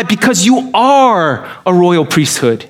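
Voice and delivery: normal talking voice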